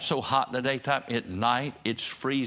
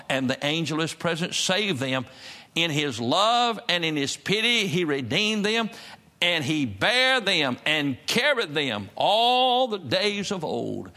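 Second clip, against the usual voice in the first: raised, drawn-out preacher's cadence; scripture proclaimed in preaching; 3 semitones higher, 3 dB louder, slower than usual. Real, not acted. Preacher reading God's promise to the congregation, fervent scripture proclamation. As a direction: swell through the verse, stretching the key words.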